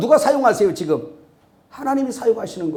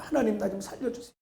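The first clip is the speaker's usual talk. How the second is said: soft plea; 3 semitones higher, 11 dB quieter than usual. Shown as a demonstration. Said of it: "목사도 그러는데 저도".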